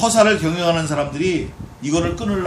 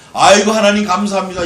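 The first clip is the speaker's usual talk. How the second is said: loud grateful cry; 3 semitones higher, 10 dB louder than usual. Shown as a demonstration. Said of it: "이래야지".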